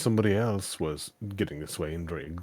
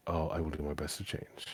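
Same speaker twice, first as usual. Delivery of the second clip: much softer, meek promise; mock meek; 4 semitones lower, 10 dB quieter than usual. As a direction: pretend to give in politely. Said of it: "but I'd be like".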